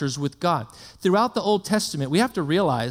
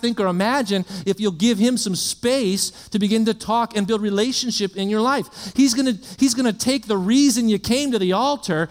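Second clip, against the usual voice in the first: higher-pitched, driving sermon delivery; building preaching; 4 semitones higher than usual. Real, not acted. Preacher promises the congregation God will overwhelm them, building preaching. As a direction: preach it in long, insistent runs, pitch climbing.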